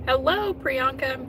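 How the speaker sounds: high, slow, sing-song hello; excited greeting. Real, not acted. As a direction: greet her brightly and drawn out. Greeting a viewer in chat.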